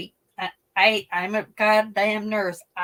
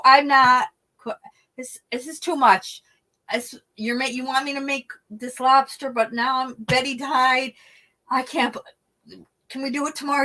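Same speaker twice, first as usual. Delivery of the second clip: upset pleading; 5 semitones higher, 7 dB louder than usual. Retold or imitated. Distraught over Betty's death.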